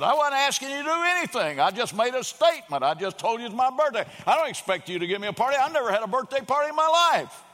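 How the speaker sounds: high, loud, fast angry reply; retold angry outburst; equal anger. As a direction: fire it back hurt and angry. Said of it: "responds back in equal anger".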